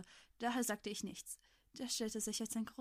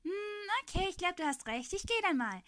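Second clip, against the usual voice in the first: put-on high, louder cheerful boy; smiling boy; 9 semitones higher, 4 dB louder than usual. A narrator's reading. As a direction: say it brightly through a smile. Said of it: "sagte er lächelnd".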